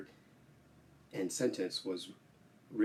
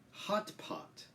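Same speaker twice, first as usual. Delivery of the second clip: ordinary talk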